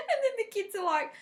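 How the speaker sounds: high laughing narration; laughing as the story continues. Real, not acted. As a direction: laugh into the next bit.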